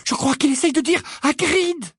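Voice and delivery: put-on high, tense girlish voice; Hermione's anxious murmur. Hermione telling Harry what the giant means, anxious. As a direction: voice pitched well up, uneasy realisation. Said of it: "murmura-t-elle".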